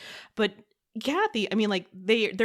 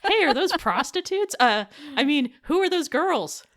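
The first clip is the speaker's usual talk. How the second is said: excited movie quote; 9 semitones higher, 6 dB louder than usual. Retold or imitated. Quoting a movie character line.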